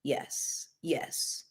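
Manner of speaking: unvoiced, breathy yes yes, barely audible; hushed delighted whisper. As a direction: whisper it like a secret cheer. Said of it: "that it's just like".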